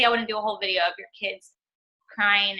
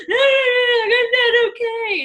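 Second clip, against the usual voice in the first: high, loud mock wail of a crying child; imitating a bawling kid; 13 semitones higher, 6 dB louder, slower than usual. Mom retelling her friend's kid bawling over cereal, mock sobbing wail. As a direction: whine it out like a sobbing toddler at dinner. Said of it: "he's bawling, crying".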